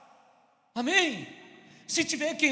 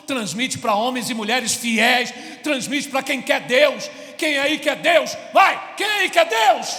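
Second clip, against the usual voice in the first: rising to a shout; preaching at full force; 3 semitones higher, 7 dB louder than usual. Real, not acted. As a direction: call it out, louder each time.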